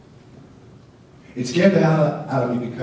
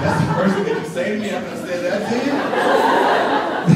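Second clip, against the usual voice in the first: laughing; 6 semitones higher, 5 dB louder than usual. Retold or imitated. Retold indignant reply, laughing.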